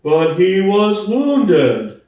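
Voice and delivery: plodding, honest workhorse; Boxer's slow voice. Acted. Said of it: "said Boxer".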